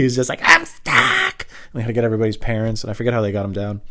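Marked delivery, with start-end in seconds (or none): 0.4-1.5 very high, loud mock wail; stuck kid. Friend calls down from the tree, high loud wail. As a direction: squeal it out from high above.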